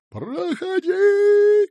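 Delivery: very high, long-drawn shout; shrieked order. A narrator's reading. Small boy letting visitor through, shrieked order. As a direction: stretch the command into a high yell. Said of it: "крикнул маленький".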